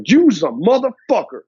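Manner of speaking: hard, punched accusation with big pitch swings; confrontational tone. Shown as a demonstration. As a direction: punch "motherfucker" like a real insult. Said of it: "See the difference? That's tonality".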